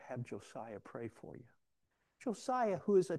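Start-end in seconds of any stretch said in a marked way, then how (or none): 0.1-1.4 much softer, understated voice; hushed quote of God's voice; still small voice. Retold or imitated. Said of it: "that still small voice said".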